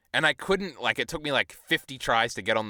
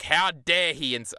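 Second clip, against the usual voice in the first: hammed-up indignation, higher and slower; mock outrage; 7 semitones higher, 4 dB louder, slower than usual. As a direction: play the offended fan, drawn out. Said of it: "I'm like".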